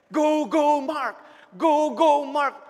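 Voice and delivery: loud chant of support; cheering. Retold or imitated. Mother chanting for her son, loud cheering. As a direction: chant it like a fan.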